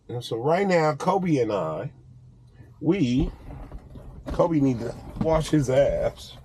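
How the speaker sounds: ordinary delivery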